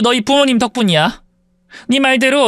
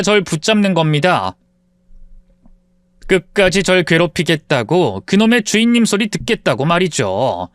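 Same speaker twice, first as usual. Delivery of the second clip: lower, subdued storyteller voice; story narration; 5 semitones lower than usual. A narrator's reading. Former maid tells listeners her story, subdued narration. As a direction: recount it calmly to the listener.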